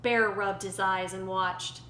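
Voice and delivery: usual speaking voice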